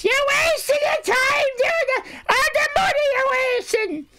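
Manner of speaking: shrieking high-pitched nag, wailing the words; hammed-up high wailing impression; Miss Sally Fan. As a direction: screech it in a piercing, put-on scolding voice. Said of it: "I'll do it in her voice".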